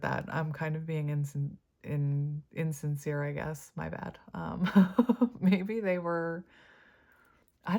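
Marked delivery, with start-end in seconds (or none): none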